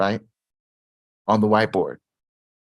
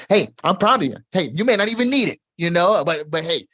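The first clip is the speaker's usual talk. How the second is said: excited praise; 8 semitones higher, 5 dB louder than usual. Real, not acted. Cheering on a viewer.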